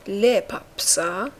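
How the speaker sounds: drawn-out, syllable by syllable; slow model pronunciation. Shown as a demonstration. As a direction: model it slowly for the class. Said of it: "teaching word drawn out". (تلفظ آهسته و کشیده)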